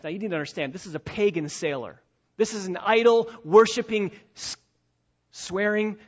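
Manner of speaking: ordinary talk, nothing marked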